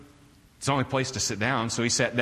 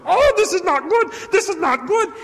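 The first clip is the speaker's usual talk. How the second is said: very high, loud frantic wail; panicked hotel worker; 6 dB louder than usual. Retold or imitated. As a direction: fret in a squeaky, alarmed worker voice. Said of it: "kept walking back and forth going".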